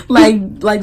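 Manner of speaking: laughing through the words; giggling while talking. Real, not acted. Laughing at own story.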